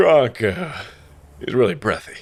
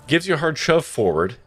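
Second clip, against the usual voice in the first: usual speaking voice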